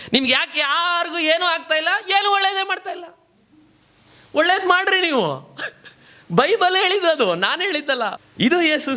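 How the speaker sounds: high-pitched, impassioned preacher; preacher in full flow; forceful preaching. Real, not acted.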